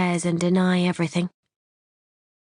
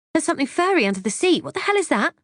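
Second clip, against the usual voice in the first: higher, fast, put-on alarm; mock alarm; 11 semitones higher, faster than usual. A narrator's reading. Wife faking alarm to husband in the car, higher and fast. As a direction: act startled at something furry. Feigned shock finding knickers.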